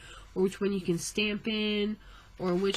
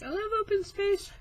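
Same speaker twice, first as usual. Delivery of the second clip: gushing; 11 semitones higher than usual. Real, not acted. Gushing over blank pages.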